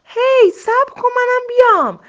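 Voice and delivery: very high, loud cry to wait; calling out. A narrator's reading. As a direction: shout after the fleeing rabbit. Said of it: "خرگوش دوم فریاد زد".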